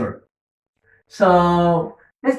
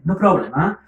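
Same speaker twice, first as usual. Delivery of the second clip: ordinary talk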